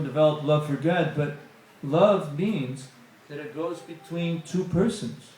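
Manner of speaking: ordinary delivery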